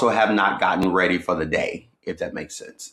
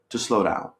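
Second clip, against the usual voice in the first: normal talking voice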